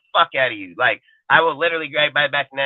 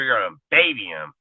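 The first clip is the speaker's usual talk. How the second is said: ordinary talk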